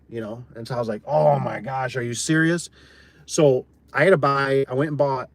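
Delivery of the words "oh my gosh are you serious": retold stunned gasp of disbelief; imitating his own shocked reaction; a touch of exaggerated disbelief; mock disbelief.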